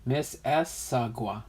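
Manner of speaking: "Miss Issaquah" slowly, syllable by syllable; careful, separated "Miss ... Issaquah"; broken down slowly. Shown as a demonstration. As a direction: sound out each part of "Miss Issaquah". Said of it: "you gotta break it down".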